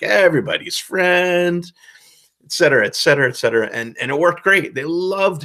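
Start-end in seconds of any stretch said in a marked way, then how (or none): 0.0-1.7 high, drawn-out ebullient merchant; everybody's friend type. Acted. Shady merchant befriending the whole table, drawn-out and effusive. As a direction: play the over-the-top chummy rogue. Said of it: "one of those guys who's, like".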